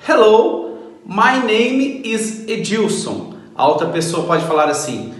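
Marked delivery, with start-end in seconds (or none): 0.1-3.4 Hello rising and higher, my name is Edilson slowed right down; slow model introduction. Shown as a demonstration. Teacher showing students how to introduce themselves, slow. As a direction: introduce yourself slowly and clearly. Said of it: "você também pode se apresentar".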